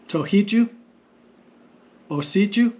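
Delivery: "Tohiju? Osiju?", each rising at the end; question tune. Shown as a demonstration. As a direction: ask "Tohiju?" and "Osiju?" with an upward lift. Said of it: "It's a question".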